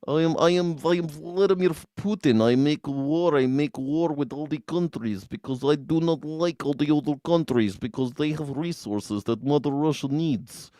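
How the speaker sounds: thick cartoon Russian accent; Vladimir Putin. Streamer as leader who makes war, thick Russian accent. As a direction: declare it in a hammed-up Russian accent. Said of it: "When I do my Vladimir Putin accent".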